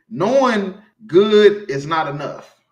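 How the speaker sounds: slow, loud preacher stress; emphatic preacher line; preaching emphasis. Acted. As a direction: drive the point home. Preaching with force.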